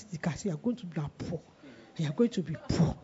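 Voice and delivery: low, hushed taunt; taunting inner voice. Retold or imitated. The voice tormenting the preacher about the car, low taunt. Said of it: "I heard that voice".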